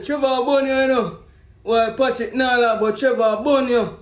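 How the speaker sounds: ordinary talk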